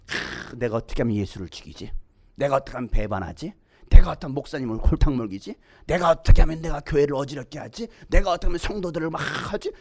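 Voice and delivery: low scheming inner voice; devil plotting. Acted. Preacher voices the devil planning betrayal, low scheming voice. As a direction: plot to yourself in a deep, sinister undertone.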